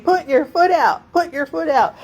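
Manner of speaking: loud, high command; instructor shouting over wind. Retold or imitated. Instructor ordering her at the open door. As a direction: bark it over engine noise. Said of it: "he's saying".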